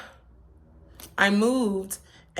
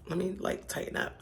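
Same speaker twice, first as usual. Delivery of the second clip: whispered aside; 10 dB quieter than usual. Real, not acted. Muttered aside while fixing herself.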